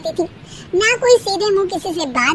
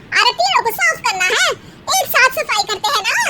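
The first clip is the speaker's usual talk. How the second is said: daughter-in-law; 7 semitones higher than usual. Acted.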